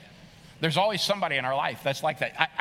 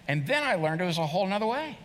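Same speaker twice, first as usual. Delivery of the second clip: ordinary delivery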